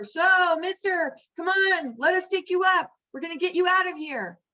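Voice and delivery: lightly hammed-up rescuer shouting; pilot calling. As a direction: helicopter pilot calling down, urging him to climb up. Helicopter pilot calls out.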